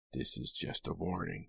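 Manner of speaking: soft, menacing undertone; hushed threat. A narrator's reading. Attacker not loosening his grip, hushed threat. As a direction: keep the warning hushed.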